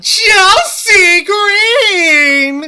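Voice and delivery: high, long drawn-out announcer yell; ring announcer call. Acted. Announcing the champion.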